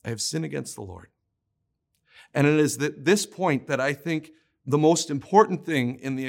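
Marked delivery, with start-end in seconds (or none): 0.0-1.1 low, soft, humble confession; king quietly confessing to the prophet. A narrator's reading. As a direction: confess it quietly, head bowed. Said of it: "most beautiful confession".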